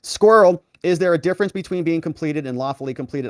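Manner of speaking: normal talking voice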